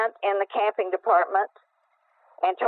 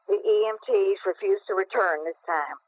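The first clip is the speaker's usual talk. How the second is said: usual speaking voice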